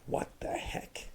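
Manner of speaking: very high, hushed mock disbelief; slow, squeaky near-whisper of astonishment; flabbergasted. Retelling his bafflement at the accent, hushed high disbelief.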